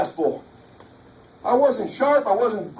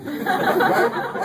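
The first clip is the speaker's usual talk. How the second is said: laughing; 10 semitones higher than usual. Real, not acted. Laughing aside to the audience.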